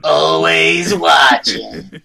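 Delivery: slow drawn-out creepy singsong; Roz impression. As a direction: drag it out like Roz. Monsters Inc Roz impression.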